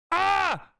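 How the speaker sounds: sharp high yelp of exasperation; high frustrated yelp. Real, not acted. Streamer yelps at absurd clip, high and exasperated.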